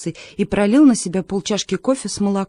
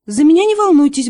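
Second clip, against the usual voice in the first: higher and louder, hurried reassurance; quickly; 8 semitones higher, 7 dB louder than usual. A narrator's reading. Mum hastily reassuring everyone, higher and louder. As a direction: blurt it out fast. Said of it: "быстро сказала мама".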